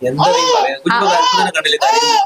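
squeaky high laughing complaint; laughing protest. Real, not acted. Father laughing as he refuses to feed the crying baby.

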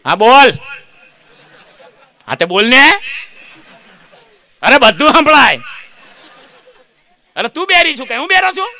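loud, fast annoyed husband on the phone; husband scolding his wife, loud and fast; irritated husband. Acted. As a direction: snap at the wife for calling the office. Husband answering wife's call.

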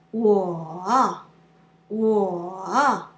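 我 drawn out low, dipping then lifting, loud; long third tone. Shown as a demonstration. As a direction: stretch the dip in 我. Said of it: "pronounce the third tone longer".

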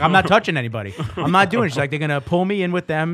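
half-mocking alarmed protest. Manager mom refusing to touch the homeless, mildly frantic.

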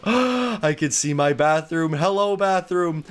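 high squealing elation with gasps and laughs; overjoyed. Real, not acted. Player finally got the flashlight, overjoyed. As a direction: squeal like the happiest person alive. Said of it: "I'm so happy".

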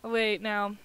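ordinary delivery

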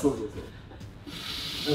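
hushed under the breath; whispering. Real, not acted. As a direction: keep it to a whisper. Whispering before the final joke.

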